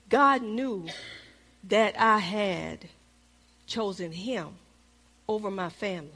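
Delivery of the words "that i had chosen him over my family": normal talking voice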